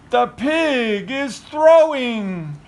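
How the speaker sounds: slow thrilled announcement; excited gasp. Acted. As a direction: announce it with wide-eyed wonder. Excited storytelling after gasp.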